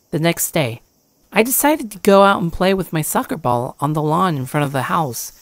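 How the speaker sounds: plain reading voice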